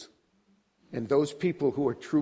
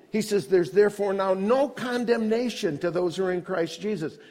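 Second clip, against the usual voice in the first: higher-pitched, forceful sermon delivery; impassioned preaching; 7 semitones higher, 4 dB louder than usual. Real, not acted. Preacher assuring the congregation of no condemnation, impassioned. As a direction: preach it with rising conviction.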